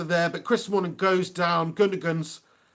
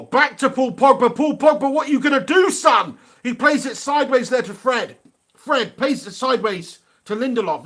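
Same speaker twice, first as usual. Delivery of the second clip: loud, lively play-by-play call; esports caster; 5 semitones higher, 6 dB louder than usual. Acted. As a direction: call the action as it happens. Commentates live play.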